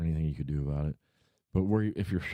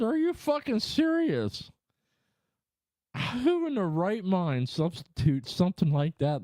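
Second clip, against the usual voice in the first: high, slow incredulous outburst; outraged disbelief; 12 semitones higher than usual. Retold or imitated. Reacting to preschool binoculars, high slow outraged disbelief. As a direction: let the outrage climb way up, slowly. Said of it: "I said".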